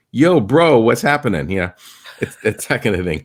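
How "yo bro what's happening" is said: higher and louder, hammed-up yo bro; a breezy slang hello, played up; imitating locals using English slang, in a hammed-up casual greeting; mock street greeting. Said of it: "you'd hear them go".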